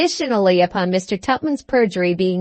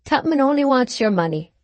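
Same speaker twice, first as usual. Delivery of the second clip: usual speaking voice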